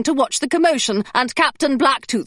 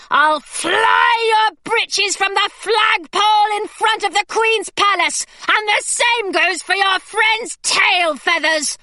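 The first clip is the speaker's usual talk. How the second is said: much higher, slow defiant threat; Hilary's brave threat; 9 semitones higher, slower than usual. A narrator's reading. Hilary threatening the parrot pirate, high and bold. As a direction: make the pirate back off.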